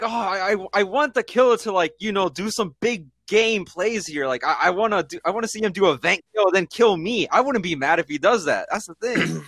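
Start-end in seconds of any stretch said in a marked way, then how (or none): 0.0-7.3 dreamy high gushing imitation; high excited fan voice. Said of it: "it's like".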